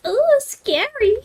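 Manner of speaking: high, slow mock fear at the scorpions; mock scared. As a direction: pretend to be scared, sarcastically. Mocking the scorpions.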